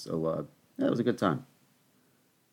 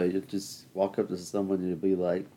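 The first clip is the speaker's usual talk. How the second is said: normal talking voice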